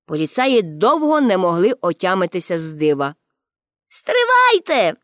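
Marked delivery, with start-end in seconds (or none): 3.9-5.0 high, drawn-out call. A narrator's reading. Pippi stops the departing policemen, calling out. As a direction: call after them, high and stretched. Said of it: "гукнула Пепі".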